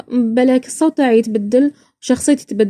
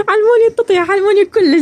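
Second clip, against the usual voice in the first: laughing; 9 semitones higher, 3 dB louder than usual. Real, not acted.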